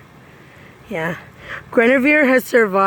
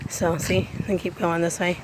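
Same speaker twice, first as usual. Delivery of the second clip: ordinary talk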